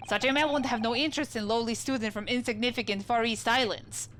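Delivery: put-on proud, broken English with a Russian accent; Russian sailor accent. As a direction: announce your name like a stern Russian crewman. Sailor defends Roylott.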